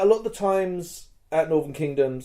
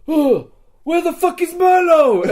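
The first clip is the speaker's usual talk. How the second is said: high, loud shout of alarm; outraged demand; 14 semitones higher, 8 dB louder than usual. Retold or imitated. Rescued player confronting the rescuer, outraged shout. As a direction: demand it at full voice, re-enacting the outrage.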